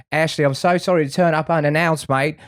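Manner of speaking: breezy chummy client, sorry-not-sorry; client in flip-flops. Retold or imitated. Client turns up at reception to redeem his art, lightly voiced cheery bloke. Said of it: "and he's like".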